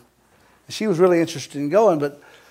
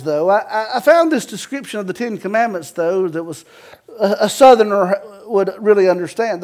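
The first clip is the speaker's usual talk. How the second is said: normal talking voice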